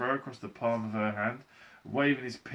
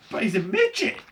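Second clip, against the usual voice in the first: high, loud shout of dismay; loud, high outburst; shouted exclamation; 14 semitones higher, 10 dB louder than usual. A narrator's reading. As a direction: shout it out in shock. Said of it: "shouted Mister T V".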